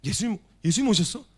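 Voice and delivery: Peter's expected frightened reaction, cried out; frightened loud cry. Acted. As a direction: blurt "예수님, 예수님" in alarm. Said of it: "이렇게 반응했어야 했단 말이에요".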